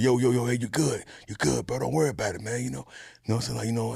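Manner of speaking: leicht nachgemachter, etwas leiserer Regisseur; imitating Corey Espy. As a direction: beruhigend und locker, ein wenig leiser.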